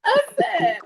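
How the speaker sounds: very high, laugh-choked voice; laughing too hard to talk; cracking up. Real, not acted. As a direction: squeal it through real laughter. Cracking up while retelling.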